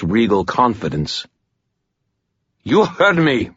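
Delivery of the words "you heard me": high, imperious snap; sharp and high, brooking no argument; snapped command. A narrator's reading. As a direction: snap it like a superior officer. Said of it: "the girl snapped".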